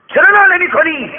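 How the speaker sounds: high loud scolding yell; angry shout. Acted. Rich man scolding beggar for moaning, angry shout. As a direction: demand it furiously.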